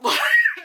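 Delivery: piercing high squeal laugh; high shriek laugh. Retold or imitated. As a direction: shriek with rushing relief. Morphine rush.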